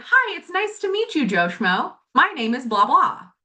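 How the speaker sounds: cheery greeting. Retold or imitated. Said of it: "as if they out loud said".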